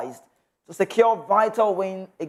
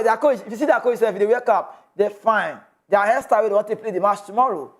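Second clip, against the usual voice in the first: ordinary talk, nothing marked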